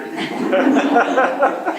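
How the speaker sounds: a burst of high real laughter; real high-pitched laugh at the story. Real, not acted. Laughing at his own palm tree story.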